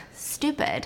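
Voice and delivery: breathy under-the-breath scorn; soft scornful whisper; whispered aside. Real, not acted. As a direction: hiss it to yourself in disgust. Aside insulting the attacker.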